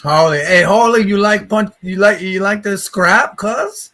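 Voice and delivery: tough local Hawaiian pidgin; rough islander taunting a newcomer; pidgin accent. Retold or imitated.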